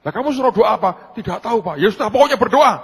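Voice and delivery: high, loud retold back-and-forth; exasperated exchange. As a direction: fire it off loudly, pitched high. Acted loud, hurried exchange with youths.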